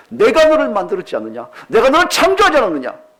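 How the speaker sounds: rising loud proclamation; God declaring. Acted. God answers the believer, rising loud declaration. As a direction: build to a high booming claim. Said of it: "하나님 뭐라고 얘기하십니까".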